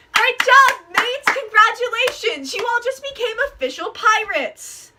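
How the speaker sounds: high, loud, excited praise; excited cheer. Real, not acted. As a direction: cheer them on with joy. Cheering the new pirates.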